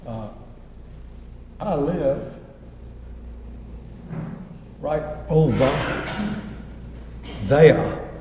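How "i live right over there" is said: slow, halting quote, letting "there" open into "ah"; dialect spelling read out. Retold or imitated. Woman telling golfers where she lives, "there" said r-less as THE-ah. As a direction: say "there" as THE-ah, with no final r. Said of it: "the A H".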